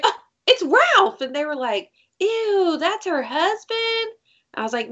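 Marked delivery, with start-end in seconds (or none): 0.0-1.2 high, thrilled realization; excited reveal. Retold or imitated. Teacher telling the kids the twist, high and thrilled. 2.2-4.1 put-on high, slow disgusted kids; grossed-out kids. Kids asking the teacher, high and grossed out.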